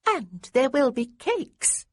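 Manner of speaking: high, slow and prim; a little girl tempting him primly. A narrator's reading. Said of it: "in a prim little voice".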